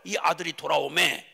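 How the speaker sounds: ordinary delivery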